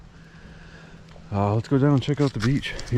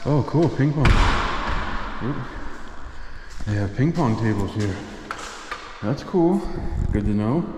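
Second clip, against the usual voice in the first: out of breath; 3 semitones higher than usual. Real, not acted. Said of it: "Sorry, I'm out of breath".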